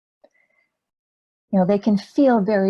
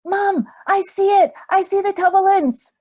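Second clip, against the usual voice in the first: put-on very high, loud child voice; excited little boy; 12 semitones higher, 8 dB louder than usual. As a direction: squeal it like a four-year-old making a discovery. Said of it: "that kind of excited curiosity".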